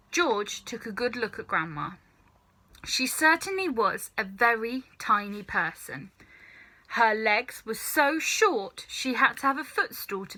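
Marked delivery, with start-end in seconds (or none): none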